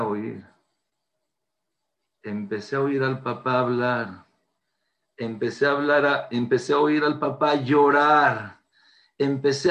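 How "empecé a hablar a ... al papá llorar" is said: normal talking voice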